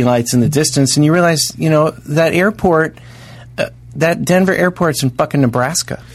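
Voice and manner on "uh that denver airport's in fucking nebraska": ordinary talk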